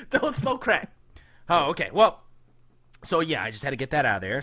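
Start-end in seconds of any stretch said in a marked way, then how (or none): 0.1-0.9 high, quicker repeat of the warning; high echo. Pretend. Echoes PSA line.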